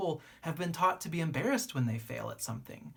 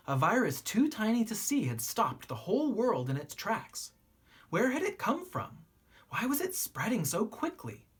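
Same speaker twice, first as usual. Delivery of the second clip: gentle, expressive picture-book reading; story narration. A narrator's reading. Narrator reading a children's book to young listeners, gently expressive. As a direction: read it like a bedtime story to kids.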